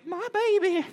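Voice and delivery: high-pitched imitation of a clinging mother; hammed-up motherly fussing; doting mom. Mom hugging her rescued son.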